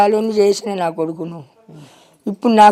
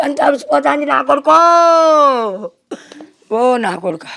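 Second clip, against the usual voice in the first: wailing lament; 3 semitones higher, 3 dB louder than usual. Real, not acted. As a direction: mourn aloud, drawing out each cry. Mother laments her dead son. (కొడుకు కోసం గుండెలు పగిలేలా రోదన)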